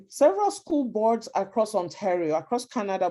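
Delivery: ordinary talk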